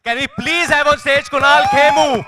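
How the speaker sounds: loud drawn-out stage call; announcer call. Real, not acted. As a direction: call the name out big and slow. Host calling guest onto stage.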